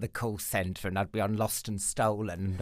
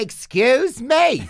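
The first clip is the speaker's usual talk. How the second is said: a slow, drawn-out, much higher and louder "excuse me"; offended; 13 semitones higher, 10 dB louder, much slower than usual. Retold or imitated. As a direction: stretch it out, mock-offended. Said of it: "I'm like".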